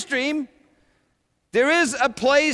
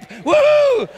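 high loud victory whoop; excited cheer; 6 dB louder than usual. Acted. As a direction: whoop like you just won a race. Celebrating crossing finish line.

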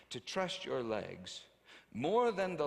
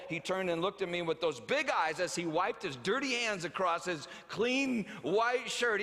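ordinary talk

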